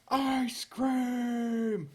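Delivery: high, loud shout of ice cream; shouted call. Pretend. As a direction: yell it to wake the neighbours. Neighbours woken by shouting.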